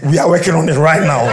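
an angel's loud "on it!"; eager loud promise. Acted. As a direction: call it out like a busy helper eager to please. Angel hurriedly reassures the man.

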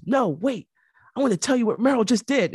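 high, fast and eager; eager interruption. Retold or imitated. Spielberg stopping his producer to brag, high and fast. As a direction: burst in, bursting to tell. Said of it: "He goes".

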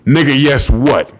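angry demand. Acted. Stepfather demanding respect.

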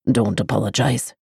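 a man soothing her, low and hushed; deep male voice. A narrator's reading. The lover to the girl, low and quiet.

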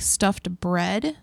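usual speaking voice

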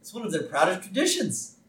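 hint of a fussy lawyer shushing Homer; lawyer's voice. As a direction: lightly play the stuffy lawyer. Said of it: "he's like".

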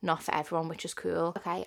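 ordinary talk

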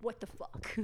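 flat, whispered what the fuck; deadpan muttered quote. Retold or imitated. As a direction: mutter it under your breath in disbelief. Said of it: "my sister's just like".

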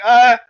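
high, loud startled yelp; a mock yelp of shock; loud high startled cry. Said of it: "he's just kinda like".

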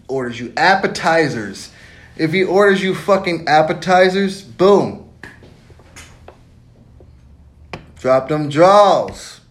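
loud, high, building hype; rising loud excitement to a slow payoff; hyped shout. Real, not acted. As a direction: yell the punchline, slowing at the end. Hyped punchline about appetizers.

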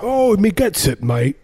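high-pitched bad Cockney; hammed-up Cockney. Parodying the movie accent again, high mock Cockney. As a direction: squeal it in stagey Cockney.

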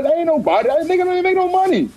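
high, fast, worked-up imitation; mock exasperated rant. Quoting the host scolding a woman guest, high fast mock rant. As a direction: rattle it off in mock outrage. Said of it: "Tell you".

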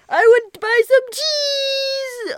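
high slow silly customer voice. Acted. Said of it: "That's what he sounded like".

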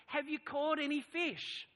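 high, loud, drawn-out call across water; yelling out. Acted. Jesus on the shore to disciples in the boat, yelling out. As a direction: holler it to a boat offshore. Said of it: "he yells out to them".

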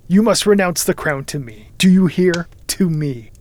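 loud, imperious command; forceful demand. Acted. Narrator orders cousin to renounce crown, imperious. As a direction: demand it loudly, driving each phrase.

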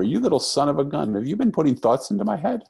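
put-on a delighted father teasing his child; playful delight. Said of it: "And I said to her".

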